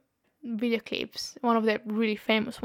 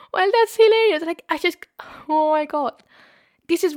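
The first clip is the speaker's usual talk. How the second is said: much higher and louder, bursting with disbelief; amused outburst; 6 semitones higher, 4 dB louder than usual. Real, not acted. Host reacting to the story, loud amused outburst. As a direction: blurt it out still laughing.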